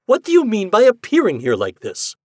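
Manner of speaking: loud, high indignant demand; stern. Acted. Husband confronts the ghost for appearing, stern and indignant. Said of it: "I said sternly".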